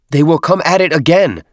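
loud, high-pitched protest; crying out. A narrator's reading. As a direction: cry out an urgent warning. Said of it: "Tartarus cried".

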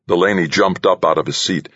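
ordinary delivery

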